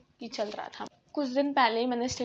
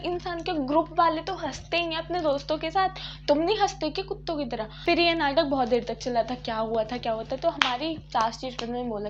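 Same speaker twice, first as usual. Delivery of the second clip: normal talking voice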